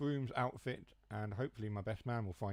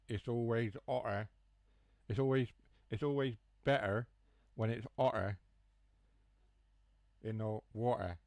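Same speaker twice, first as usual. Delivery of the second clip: lightly put-on West Country accent, a little louder; West Country-tinged accent; 4 dB louder than usual. Shown as a demonstration. Streamer showing viewers how home talk sounds, West Country-tinged accent. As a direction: demonstrate dropped T's in a West Country lilt. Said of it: "So you say things like".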